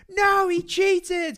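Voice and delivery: high, slow indignant protest; shocked childish outrage, drawn out; outraged kid yell. Retold or imitated. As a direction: cry foul like a betrayed young fan. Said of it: "and we're like".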